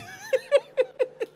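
long, high-pitched giggling laugh; high laughter. Real, not acted. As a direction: laugh helplessly in high bursts. Laughs at his handshake story.

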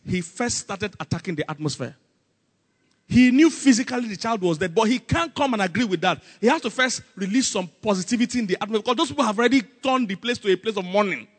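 ordinary talk, nothing marked

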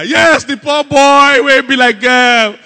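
slow, booming wail; loud mock crying. Professional crier corrected about the dead, wailing on regardless. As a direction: keep up the hammed-up mourning, loud and drawn out. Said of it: "So this was loud crying".

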